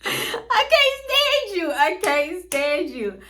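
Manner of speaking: slow, high, mock-crying wail; hammed-up whimpering complaint, drawn out. To her boyfriend who keeps laughing, in a slow, high mock wail.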